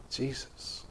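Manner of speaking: breathed out, barely voiced; awed whisper. Real, not acted. Preacher whispers the name in reverent awe. Awed aside about Jesus.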